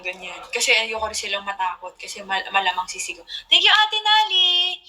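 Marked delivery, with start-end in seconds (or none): 3.5-4.8 high, loud, drawn-out thank you; excited thanks. Real, not acted. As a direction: sing out thanks to a viewer, slowly. Thanking a viewer mid-story. (masiglang pasasalamat, mataas at malakas)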